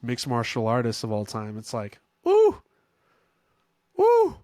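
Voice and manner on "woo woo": sudden high, loud whoop of delight; excited whoop. Real, not acted. Fan hyped about Jones versus Pereira, whooping.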